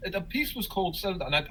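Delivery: normal talking voice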